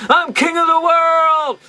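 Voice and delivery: high, slow, triumphant mock cry; James Cameron Titanic yell. Reviewer mimics Galvatron's triumph as a Titanic shout. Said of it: "he does a James Cameron".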